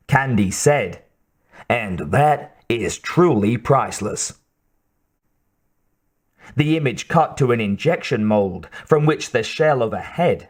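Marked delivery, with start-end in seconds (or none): 1.7-4.4 slow, light Texas drawl; understated Texas drawl. A narrator's reading. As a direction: draw it out like a Texas soldier in an ad. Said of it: "his Texas drawl thick".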